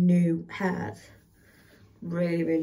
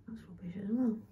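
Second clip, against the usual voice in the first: whispering; 3 semitones higher, 8 dB quieter, faster than usual. Real, not acted. Muttering to herself while parting.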